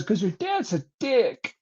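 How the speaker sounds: high, soft, slow mock weeping; fake crying. Mom blaming dad as a prank, mock crying. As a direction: wail it softly, imitating a tearful mom. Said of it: "made it sound like she was fucking crying".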